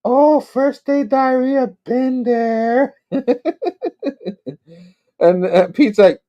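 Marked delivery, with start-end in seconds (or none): 0.0-2.9 high, drawn-out tipsy falsetto; hammed-up drunken lady voice; drunk weird lady. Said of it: "she's like". 3.1-4.5 high-pitched put-on tipsy giggle; acted drunk lady's giggle. Acting the drunk lady laughing.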